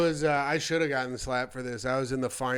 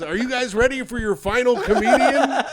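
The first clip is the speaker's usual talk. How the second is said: raised, louder announcer pitch; show host's introduction; 7 semitones higher, 5 dB louder than usual. Retold or imitated. Host bringing up the last comic, imitated announcer call. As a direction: hype the crowd for the next act. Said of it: "And then he goes".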